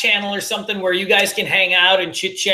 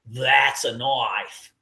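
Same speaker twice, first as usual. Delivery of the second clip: slow, low Australian line that lands on "knife"; "That's a knife" drawn out Aussie style; Australian accent; 9 semitones lower, 4 dB quieter, much slower than usual. Retold or imitated. Topping the first line as Crocodile Dundee, Australian accent. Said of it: "I just think of Crocodile Dundee".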